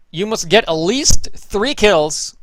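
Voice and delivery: ordinary talk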